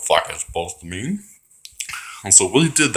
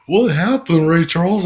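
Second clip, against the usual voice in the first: mocking taunt; 8 semitones higher, 4 dB louder than usual. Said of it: "And I was like".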